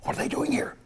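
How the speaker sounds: high airy mock whisper on "What are they doing here"; retold church gossip, hushed; imitated whisper. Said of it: "they were whispering".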